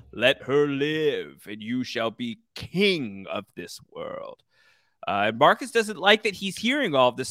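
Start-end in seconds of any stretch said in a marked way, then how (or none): 0.1-4.4 slow, portentous otherworldly voice; drawn-out mock-ominous decree; voice in the head. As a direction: intone it like a prophecy ringing in someone's skull. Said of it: "The voice rings in Marcus's head".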